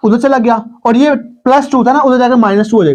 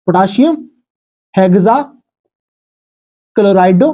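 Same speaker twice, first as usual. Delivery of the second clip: Potassium हेक्साक्लोराइडो spelled out slowly, syllable by syllable; slow dictation; 3 semitones lower, much slower than usual. Shown as a demonstration. (Potassium हेक्साक्लोराइडो को धीरे-धीरे खींचकर बोलना)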